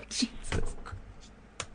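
breathy whisper of disbelief; whispered swear. Real, not acted. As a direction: whisper it under your breath, stunned. Shocked after the robot reply.

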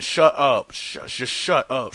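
a light mock of the shrieking lady; slow mock shut up; imitating Shut Up Lady. Caller imitates the voicemail lady saying shut up. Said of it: "you sorta sound like me in that".

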